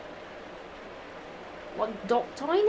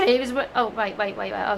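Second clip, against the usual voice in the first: plain reading voice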